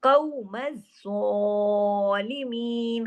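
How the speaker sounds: very slow chanted recitation; Quran recitation. Shown as a demonstration. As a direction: recite with idgham, drawn out. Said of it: "Urdu की तरह नहीं बल्कि काफ से कौ". (धीमी तजवीद वाली तिलावत)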